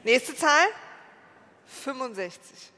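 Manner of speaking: slow, drawn-out number call; bingo caller. Acted. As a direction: announce each number slowly to the hall.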